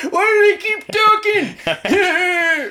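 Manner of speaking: put-on high, loud grumpy old man. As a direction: squeal it like a cranky old man. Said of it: "that old man still trying to".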